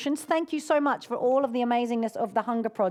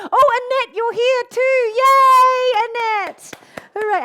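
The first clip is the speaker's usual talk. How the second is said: delighted squeal; 12 semitones higher, 12 dB louder, slower than usual. Real, not acted. Spotting the winner online, thrilled.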